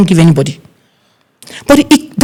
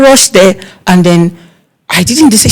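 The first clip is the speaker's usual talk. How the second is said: ordinary talk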